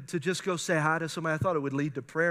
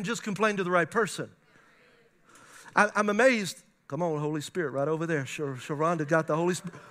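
ordinary delivery

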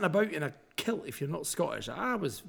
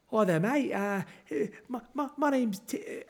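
higher-pitched mock Australian-English accent; half-Australian, half-English accent; 9 semitones higher than usual. Kilted stranger introducing himself at wedding, mangled Australian-English accent. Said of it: "kinda half Australian, half English".

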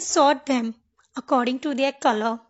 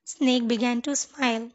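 ordinary delivery, nothing marked

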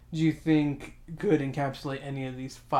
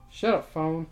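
ordinary delivery

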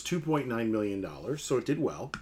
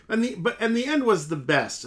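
higher-pitched American accent demo; 8 semitones higher than usual. Shown as a demonstration. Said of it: "In American, it's like".

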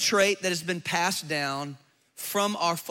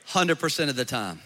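sheepish husband; 8 semitones lower than usual. Pretend. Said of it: "look at your wife and go".

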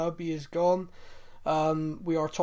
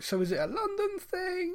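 high-pitched baffled question; mock puzzlement; 13 semitones higher, 4 dB quieter than usual. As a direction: rise up high in exaggerated confusion. Acts out own puzzled question.

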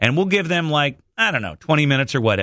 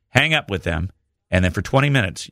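normal talking voice

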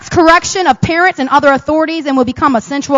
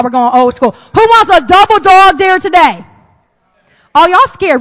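ordinary delivery

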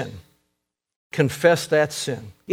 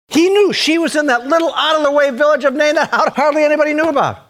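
excited; 8 semitones higher, faster than usual. Real, not acted. Excited telling of Nain.

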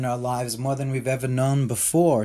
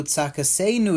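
ordinary talk

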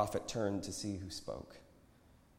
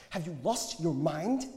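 raised pitch, scolding disbelief; hissed disbelief; 8 semitones higher than usual. A narrator's reading. Friend confronts the prophet in public, hissed disbelief. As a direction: scold him in an urgent, higher voice. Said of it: "hissed as he approached".

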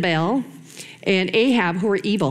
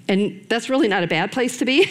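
usual speaking voice

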